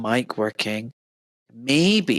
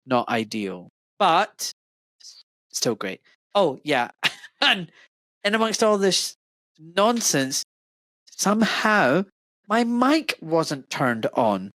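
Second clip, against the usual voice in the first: ordinary delivery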